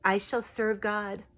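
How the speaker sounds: a gentle tree spirit softly offering help. Retold or imitated. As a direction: speak as the healing tree, hushed and reverent. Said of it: "what I heard in that parking lot".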